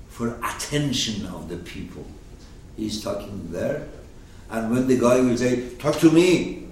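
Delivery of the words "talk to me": raised, higher-pitched insistence; adversary's loud demand. Acted. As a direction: demand attention loudly. Acted adversary demanding attention.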